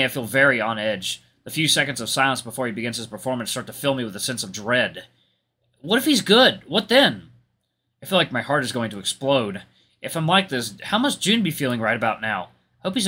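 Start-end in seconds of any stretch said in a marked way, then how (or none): 5.8-7.3 high, louder worried questioning; anxious. Acted. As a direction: fret aloud, voice pitched up. Narrator's dread before rival performs.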